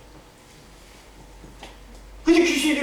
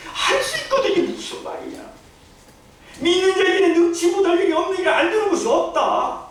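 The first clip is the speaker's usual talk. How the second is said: much higher, with laughter, mock outrage; hammed-up angry Jesus; 9 semitones higher than usual. Preacher replays Jesus' rebuke to the father, hammed-up and laughing. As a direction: ham up the rebuke, laughing as you go. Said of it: "예수님이 화가 났어요".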